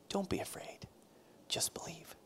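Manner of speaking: soft reverent whisper; hushed, tender whisper of the verse. Real, not acted. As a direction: breathe the promise quietly. Sermon climax, hushed quote.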